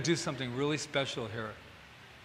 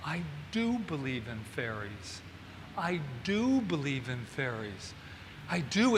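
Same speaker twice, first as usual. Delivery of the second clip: quietly convince yourself, word by word; hushed declaration of belief; 3 semitones lower, 6 dB quieter than usual. Retold or imitated. Acting Peter Pan's first declaration.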